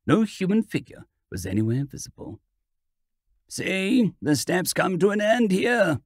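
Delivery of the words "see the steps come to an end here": ordinary delivery, nothing marked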